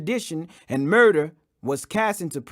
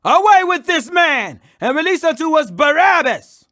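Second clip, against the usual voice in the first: loud, high crowd cry; raised mob shout demanding Barabbas; 8 semitones higher, 7 dB louder than usual. Acted. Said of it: "they cried out all at once".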